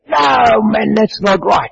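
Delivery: an angry friend refusing in the doorway, shouting; retold angry shout. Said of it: "he got so cross with me".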